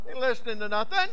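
ordinary talk, nothing marked

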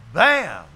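loud mock slam; phone slam noise. Dad hanging up on the caller, loud slam sound. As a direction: bang it down like a phone. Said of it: "You ain't ever heard a phone slam".

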